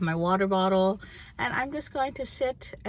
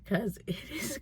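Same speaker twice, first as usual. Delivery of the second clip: whisper; 2 semitones higher, 9 dB quieter than usual. Real, not acted. Whispered aside while filming.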